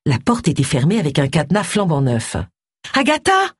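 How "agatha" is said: high-pitched call of her name. A narrator's reading. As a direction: call up to someone out of sight. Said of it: "appela Tony". (appel à voix haute, aigu)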